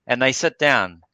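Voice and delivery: usual speaking voice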